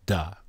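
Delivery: a short, quiet "Da." as a single syllable; syllable sound. Shown as a demonstration. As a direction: sound out "Da." plainly. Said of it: "How is it pronounced?".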